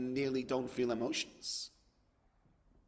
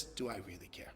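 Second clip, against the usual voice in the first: mock indifferent mumble; 7 semitones lower, 14 dB quieter than usual. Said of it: "he says".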